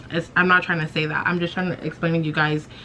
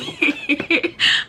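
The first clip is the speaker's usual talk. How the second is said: very high giggle; pained cover-up laugh. Retold or imitated. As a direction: laugh the embarrassment away. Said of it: "laughing the pain away".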